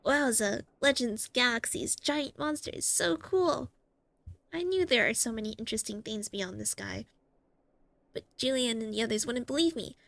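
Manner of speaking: put-on bubbling childish wonder; excited kid voice. Voicing Hook excited.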